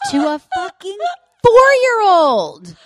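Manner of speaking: loud, high outraged cry; indignant shriek at the absurdity; outraged yell. Real, not acted. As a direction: shout it in disbelief. Outraged at mother's words.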